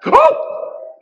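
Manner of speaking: loud, sharp gasped "oh"; shocked gasp. A narrator's reading. Witch realizes she forgot the door, loud gasp. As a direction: suck in air in sudden shock.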